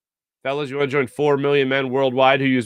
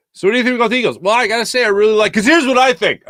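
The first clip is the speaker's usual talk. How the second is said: mock pushy fan; 7 semitones higher, 5 dB louder, faster than usual.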